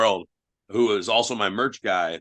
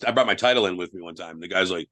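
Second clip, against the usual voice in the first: ordinary talk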